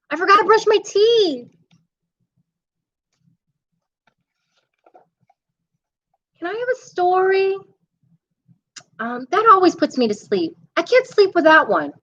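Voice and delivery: put-on pleading little boy at bedtime, voice up high; a kid bargaining to stay awake; high child voice.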